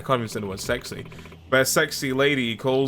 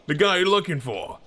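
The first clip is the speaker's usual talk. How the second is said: higher, swaggering tough-guy voice; brash impostor; 5 semitones higher than usual. Acted. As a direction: announce yourself like a big shot, pitch raised.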